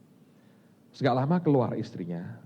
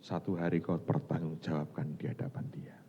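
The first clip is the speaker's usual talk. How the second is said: hushed whisper, much softer; solemn whisper; 6 semitones lower, 9 dB quieter than usual. Acted. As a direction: whisper God's warning gravely. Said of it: "Makanya Tuhan berkata". (bisikan khidmat)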